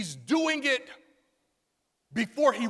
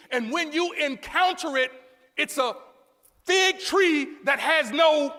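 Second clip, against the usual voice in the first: raised pitch, mounting sermon build; full-force preaching; 5 semitones higher, 4 dB louder than usual. Acted. Preacher incredulous at a fruitless tree, full-force preaching.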